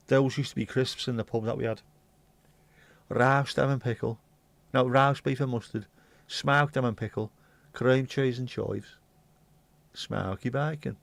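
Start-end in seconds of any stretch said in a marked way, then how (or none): 3.1-11.0 Brummie accent. Shown as a demonstration. Remembering the pub crisps, Brummie accent. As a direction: list pub crisps in a Birmingham voice. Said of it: "All crisps sound better in a Brummie accent".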